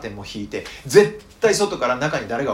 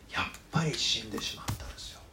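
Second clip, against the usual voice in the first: whispered, much softer; hushed whisper; 3 semitones lower, 11 dB quieter than usual. Real, not acted. Preacher telling the congregation Jesus died, whispered. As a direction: breathe the words out in sorrow.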